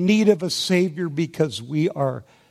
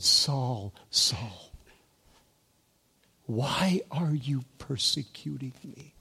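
soft, sinking into a whisper, pleading; pleading love; 3 semitones lower, 8 dB quieter than usual. Retold or imitated. Preacher voicing Jesus gently calling Saul, pleading whisper. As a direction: let it fall to a tender whispered plea. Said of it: "Can you hear the pleading love in that statement?".

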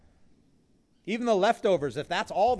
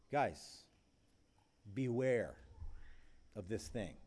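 much softer, hushed caution; soft warning; 4 semitones lower, 8 dB quieter, slower than usual. Acted. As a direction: lean in and warn them quietly. Jesus teaching softly.

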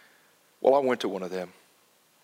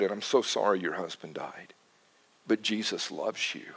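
soft consolation; 4 semitones lower, 7 dB quieter than usual. Real, not acted. Consoling the widow.

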